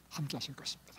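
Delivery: trailing off into a whisper; soft whispered close. Real, not acted. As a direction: let the promise fade to a whisper. Fading whisper end.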